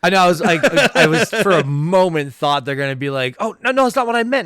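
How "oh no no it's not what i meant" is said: flustered, much higher and fast; a hammed-up flustered backpedal; befuddled. Imagining the actor caught off guard, mock flustered. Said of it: "have him, like, befuddled".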